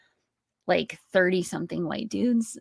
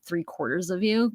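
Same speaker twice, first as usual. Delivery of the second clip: ordinary talk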